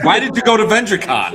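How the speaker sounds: high, loud mocking whine; squeaky teasing voice; mocking Gary. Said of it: "Gary's like".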